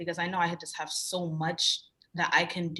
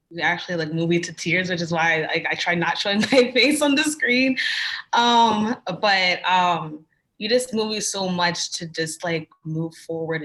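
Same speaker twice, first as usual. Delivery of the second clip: emotional; 9 dB louder than usual. Real, not acted. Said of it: "I'm emotional".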